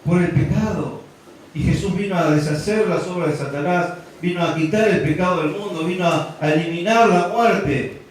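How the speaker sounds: a pastor building up to a climax; fervent preaching. Acted. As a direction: preach it out with full force. Preaching at full force.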